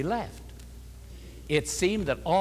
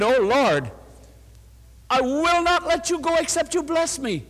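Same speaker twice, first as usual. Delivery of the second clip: much higher, ringing cry to God; fervent plea; 9 semitones higher than usual. Retold or imitated. Woman reaching up to her husband, high fervent cry. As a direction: cry it out like Jacob wrestling. Retold prayer repeated with fervour.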